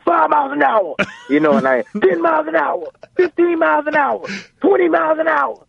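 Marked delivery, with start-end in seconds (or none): 0.0-1.0 very high-pitched mock yell; screaming out. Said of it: "He's screaming out". 2.0-5.6 screaming out. Retold or imitated. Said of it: "He's screaming out".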